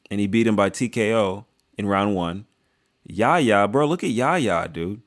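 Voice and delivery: ordinary talk, nothing marked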